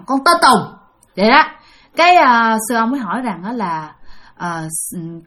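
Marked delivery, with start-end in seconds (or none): 0.1-0.7 blunt and short, a bit sharper, name only; curt answer. Shown as a demonstration. Narrator showing the rude way to answer, curt and blunt. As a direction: drop the politeness, answer curtly. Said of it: "Còn lần này nói rằng".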